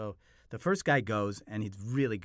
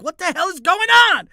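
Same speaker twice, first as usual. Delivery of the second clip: very high, very loud bewildered yell; disbelieving shout; 18 dB louder than usual. Retold or imitated. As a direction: scream your confusion at the room.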